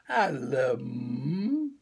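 slow, fading sleepy words; sleepy cub drifting off mid-sentence; falling asleep. Acted. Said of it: "didn't even finish".

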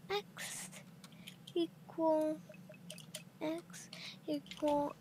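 hushed, slow whisper to self. Real, not acted. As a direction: mutter the numbers under breath. Quiet coordinate reading.

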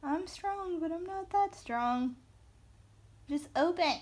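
high mock voice of a stubborn marker; playful voiced marker; marker's voice. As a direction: give the marker a squeaky complaining voice. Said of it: "It's like".

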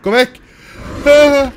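high, loud terrified yelling; real fright, shrieking go away; panicked screaming. Real, not acted. Monster attacks the player.

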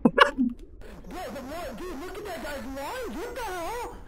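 high, amazed disbelief; astonished. Real, not acted. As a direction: sound baffled and amazed. Astonished at a strange sight.

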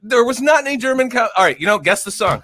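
high, fast, flustered protest; exasperated. Real, not acted. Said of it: "I don't wanna argue about this".

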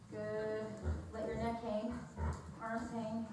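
hushed, unhurried stretching guidance; calm cool-down. Real, not acted. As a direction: ease them into the stretch, quietly. Trainer guiding cool-down stretch.

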